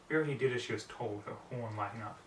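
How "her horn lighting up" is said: usual speaking voice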